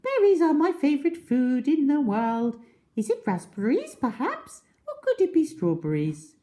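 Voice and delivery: very high, lilting bird call; roly-poly bird singing hello; sing-song bird. Acted. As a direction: chirp a cheerful greeting. Bird guesses eagerly.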